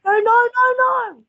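high, loud panicked nos; yelling no in panic; panicked screaming no. Real, not acted. Glitch boss stuns laser.

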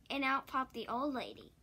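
ordinary delivery

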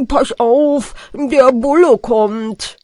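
loud, drawn-out warning cry; shouted warning from a mumbler; mumbler's shout. A narrator's reading. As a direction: call out slowly and loudly, thick-tongued. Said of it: "Da schrie Nuschelpeter".

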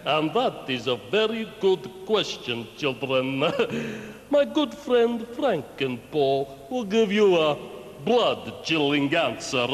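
put-on low, hammy Count's vampire accent; ridiculous vampire accent. Count Dogula answering children's question about blood, ridiculous vampire accent. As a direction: intone it like a pantomime Transylvanian vampire, laughing evilly. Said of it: "talking in that ridiculous accent".

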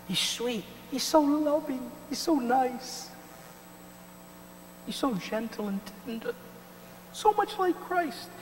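high, sugary mocking coo; mock sweet gushing. Preacher mocking believers charmed by the Antichrist, high sugary coo. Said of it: "And if you just think".

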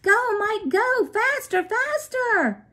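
loud, high crowd cheer; mother cheering her son from the stands, shouting. A narrator's reading. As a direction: scream him home from the stands. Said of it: "began to shout".

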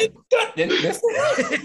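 high laughing imitation of the announcer; mock announcer call. Mocking the announcer's blown call, high and laughing. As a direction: squeak it out like the flustered announcer. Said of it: "He was like".

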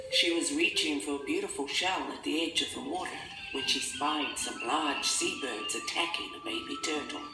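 ordinary delivery, nothing marked

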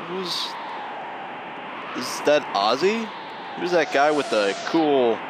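ordinary delivery

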